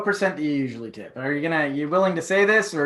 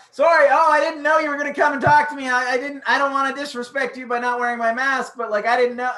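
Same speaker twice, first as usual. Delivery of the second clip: high, loud, gushing mock apology; over-apologize in a raised, flustered voice; 9 semitones higher, 4 dB louder than usual. Diner apologizing to the server for being unmasked, flustered.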